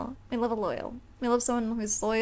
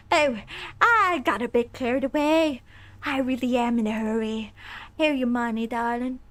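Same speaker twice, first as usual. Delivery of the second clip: Lady Mance voice. Acted. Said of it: "doing her voice".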